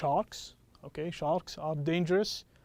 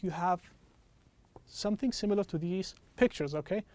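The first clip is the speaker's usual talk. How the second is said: normal talking voice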